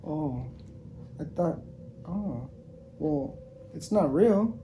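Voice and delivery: ordinary delivery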